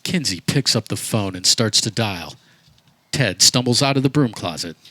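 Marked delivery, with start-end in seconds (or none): none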